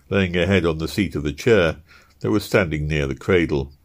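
ordinary delivery, nothing marked

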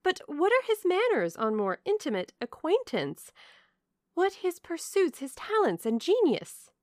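high, insistent indignant questioning. A narrator's reading. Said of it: "cried Marianne indignantly".

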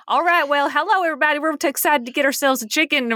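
hammed-up Southern accent; fake Southern accent. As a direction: play at being a Southerner ordering food. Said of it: "we're gonna be Southern".

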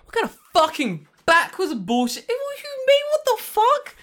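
high, loud, laughing disbelief; a kid yelling at the TV, half laughing; childhood outrage. Retold or imitated. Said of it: "I remember as a kid watching this being like".

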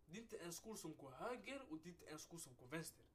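normal talking voice

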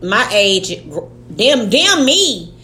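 normal talking voice

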